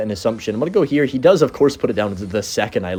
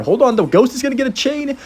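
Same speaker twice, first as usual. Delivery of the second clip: surprised; 13 semitones higher than usual. Real, not acted. Surprised by game event.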